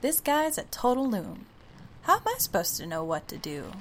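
usual speaking voice

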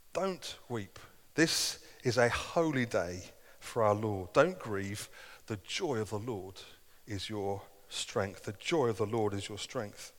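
tender quote. Retold or imitated. Nehemiah's words, gentle quote.

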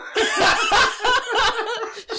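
high delighted laugh; loud burst of laughter. Real, not acted. Surprised by the drink taste.

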